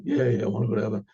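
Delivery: low, fast, bored yeah yeah; half-hearted yes. Retold or imitated. People answering about heaven, low and bored. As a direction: brush it off quickly.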